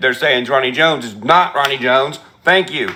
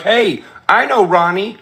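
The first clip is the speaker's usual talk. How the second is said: higher, slower mock of a shady guy; lightly voiced sneering impostor; mocking quote; 7 semitones higher than usual. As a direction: drag it out like a smug liar. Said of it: "this guy who's like".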